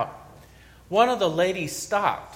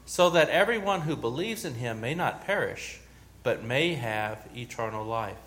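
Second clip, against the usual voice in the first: measured, reverent Gospel reading, lower and softer; solemn scripture reading; 4 semitones lower, 4 dB quieter than usual. Acted. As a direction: read the Gospel to the congregation with quiet gravity. Reads the Gospel aloud.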